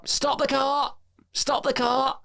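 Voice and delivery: high mock-shouted old nan; nan shouting. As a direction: imitate nan demanding you stop. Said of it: "she's like".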